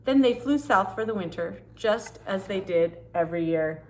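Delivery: put-on lively read-aloud for children; storybook reading. Reading a picture book to kids, storytelling voice.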